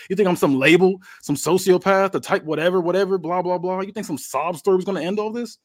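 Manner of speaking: high, loud mocking tirade; scornful rant. Wrestler drops the remorse act on Jeff, scornful rant. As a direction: throw the fake sob story back in his face.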